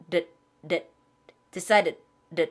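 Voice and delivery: 르 soft flap after decided; soft final d. Shown as a demonstration. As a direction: model the light ending sound. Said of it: "르 이렇게 표현하시면". (끝소리 르 가볍게)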